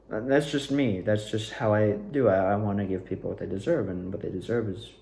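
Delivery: ordinary talk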